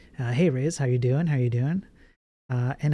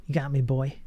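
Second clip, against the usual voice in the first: playful mock defeat. Conceding a point to chat, playful put-on voice. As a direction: throw it back at chat with a grin.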